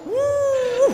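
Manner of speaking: high, laughing exhale of relief; a quick, comic high gasp; mock gasp. Santri's reaction exhales.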